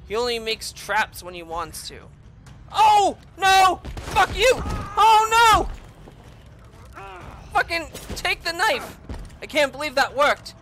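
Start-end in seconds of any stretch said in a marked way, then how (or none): 2.7-9.0 high, loud cries of alarm; real startled shouts; panicked shouting. Real, not acted. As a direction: yell in panic at the pursuer. Panics as pursuer appears.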